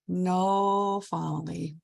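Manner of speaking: put-on Polish-accented guest counting clubs, drawn out. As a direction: play the Polish visitor slowly searching for words. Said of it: "I gotta stop talking like this".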